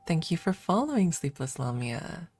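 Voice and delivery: ordinary talk, nothing marked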